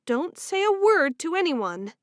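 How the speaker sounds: lightly voiced urgent sister; young woman voice. Female character voice in story.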